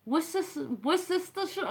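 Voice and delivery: halting, high-pitched go at "Worcestershire" with an extra "sis"; tongue-tied attempt. Real, not acted. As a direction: trip over the sibilants in "Worcestershire". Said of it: "I'm turning into Sean Connery".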